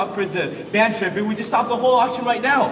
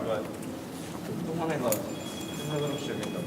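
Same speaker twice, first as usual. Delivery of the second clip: tender sweet talk; 8 semitones lower, 13 dB quieter than usual. Acted. Tender to girlfriend.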